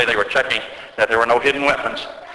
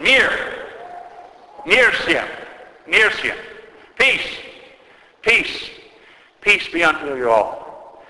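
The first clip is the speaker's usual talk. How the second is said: retold cry of peace, high-pitched calls; 7 semitones higher, slower than usual. Metropolitan calls for peace to an angry crowd, crying out high. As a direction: call out each word high, spacing them apart, then draw out the last. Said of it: "he yelled out unto them".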